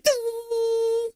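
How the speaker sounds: very high, tiny ding sound; a squeaky electronic beep; imitated camcorder ding. Host mimics camera, a high ding.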